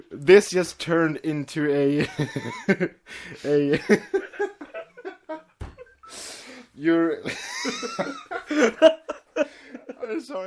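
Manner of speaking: laughing breathlessly; can't get words out for laughing. Real, not acted. Cracking up while reading pony quotes, helpless laughter. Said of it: "laughing through lines".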